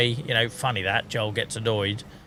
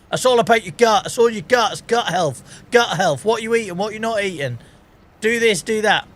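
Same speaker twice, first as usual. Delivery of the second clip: higher-pitched, insistent mimicry; mocking know-it-all advice; 7 semitones higher than usual. Streamer mimicking people with gut theories, mockingly insistent.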